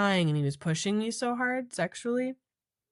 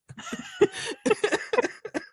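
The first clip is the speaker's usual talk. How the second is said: high-pitched laughing fit; helpless high laugh; real laughter. Real, not acted. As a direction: crack up in high giggles. Cracks up at her own story.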